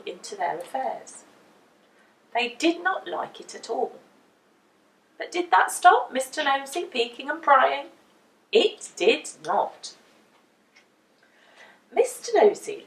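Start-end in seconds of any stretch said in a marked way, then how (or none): none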